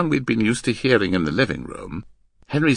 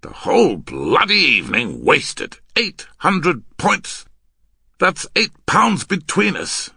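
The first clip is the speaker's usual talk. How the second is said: loud, higher-pitched rebuke; exasperated scolding; 9 semitones higher, 8 dB louder than usual. Acted. Man berating his partner for the wasted evening, exasperated.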